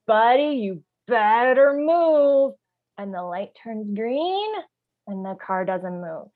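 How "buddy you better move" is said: louder, higher, drawn-out threat; angry warning. Pretend. Driver honking at the stalled car, now openly angry. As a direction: let the patience snap on the last word. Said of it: "You go like".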